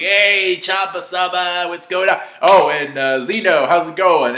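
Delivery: loud, high-pitched cheery holler; Goku yelling hello. Acted. Goku greeting gods across the palace, yelling rudely. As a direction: call out with no manners at all. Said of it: "he's yelling at Champa".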